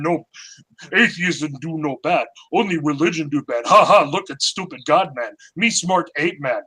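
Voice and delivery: mock caveman. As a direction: grunt it like a dumb caveman mocking atheists. Mocking atheists as cavemen.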